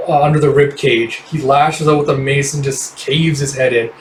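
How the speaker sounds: ordinary delivery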